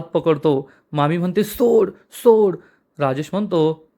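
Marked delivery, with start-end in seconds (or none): 1.4-2.6 high, drawn-out plea to be released; narrated desperate protest, higher and stretched; desperate cry. A narrator's reading. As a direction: cry out slowly to break free. Aunt struggles as her hand is grabbed.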